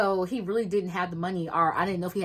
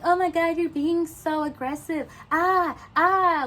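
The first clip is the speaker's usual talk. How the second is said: high-pitched, exaggerated fright; mock scared; 9 semitones higher, 4 dB louder than usual. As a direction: mockingly imitate a panicked coworker with little yelps. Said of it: "trying to look all scared and shit".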